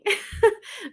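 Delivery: short high giggle; high laugh. Real, not acted. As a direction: laugh at yourself. Laughs at herself.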